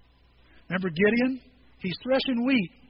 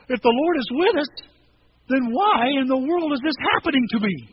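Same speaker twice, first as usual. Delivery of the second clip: louder, higher sarcastic complaint; sarcastic frustration; 4 semitones higher, 6 dB louder than usual. Retold or imitated. Gideon complaining to the angel, louder and sarcastic. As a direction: throw the question back bitterly. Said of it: "Sarcasm? Frustration? Absolutely".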